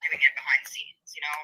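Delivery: hushed conspiratorial whisper. Acted. Streamer hinting at secret reporting, whispered aside. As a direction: let the chat in on a secret.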